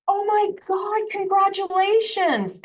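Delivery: high, bright-eyed gushing congratulations; excited congratulations. Retold or imitated. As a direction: gush at her like great news.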